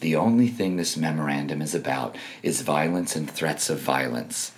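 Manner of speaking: put-on soft, low, feeble old man; mock a pitiful, quiet victim voice; Merrick Garland. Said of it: "He literally sounds like that".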